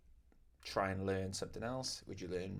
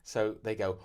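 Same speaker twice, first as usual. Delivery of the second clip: ordinary talk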